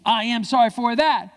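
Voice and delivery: loud, high, word by word; slow, emphatic teaching delivery; call-and-repeat demo. Shown as a demonstration. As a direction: lead a crowd to repeat it. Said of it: "Everyone say".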